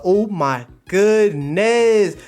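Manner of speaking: loud, slow drawn-out exclamation; hammed-up amazement. Streamer reacting to a comic panel, mock amazement. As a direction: drag out the last word in mock disbelief. Storyteller's exaggerated shocked reaction.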